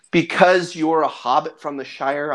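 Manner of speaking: usual speaking voice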